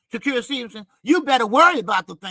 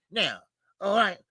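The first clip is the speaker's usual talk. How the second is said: normal talking voice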